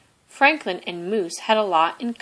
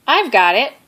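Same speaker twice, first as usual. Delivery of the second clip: loud eager call from Moose; cried out; 5 semitones higher, 9 dB louder than usual. Acted. Moose calling to the soccer friends, loud eager cry. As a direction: shout up to the tree, eager to help. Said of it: "cried Moose".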